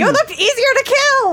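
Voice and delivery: very high, loud screech; shrieking creature retort; exasperated creature. Acted. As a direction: yell it back at a very high pitch. Creature shouts back in quarrel.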